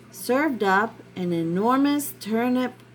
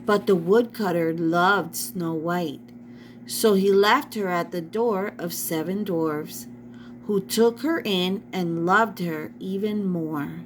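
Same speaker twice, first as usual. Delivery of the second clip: usual speaking voice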